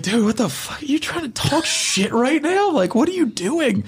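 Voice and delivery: wide-eyed, what-are-you-doing panic; scolding a buddy for mouthing off to a guard, high and panicked; incredulous outburst. Retold or imitated. Incredulous at buddy talking back.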